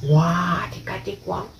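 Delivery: ordinary delivery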